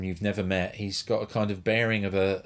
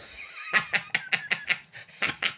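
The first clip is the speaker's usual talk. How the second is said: very high cackling laugh; high mock cackle. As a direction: imitate a wheezy rock-star cackle. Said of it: "[name] does this".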